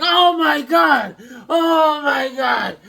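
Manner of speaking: loud, high, drawn-out wail; hammed-up horrified shout; anguished outcry. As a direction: cry out in mock agony, stretching every word. Retold reaction to damaged package.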